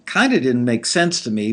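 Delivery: usual speaking voice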